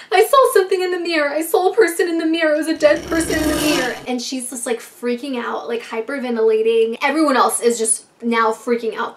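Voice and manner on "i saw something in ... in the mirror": higher, louder frantic report; panicked. Retold or imitated. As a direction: blurt it out terrified. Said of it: "She's like".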